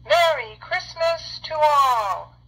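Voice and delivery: high, loud and slow Christmas farewell; ringing slow goodbye called from afar; joyful exclamation. A narrator's reading. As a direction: exclaim it out over the rooftops. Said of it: "I heard him exclaim".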